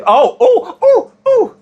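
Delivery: high laughing squeals rising; real thrill jumping way up in pitch; excited squeal. Real, not acted. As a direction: break into delighted squeals. Excited burst during reading.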